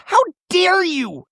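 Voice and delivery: shrieky high, drawn-out indignation; hammed-up slow, high affront; mock outrage. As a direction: imitate an outraged housewife, pitch sky-high. Imitating Shannon's outraged retort.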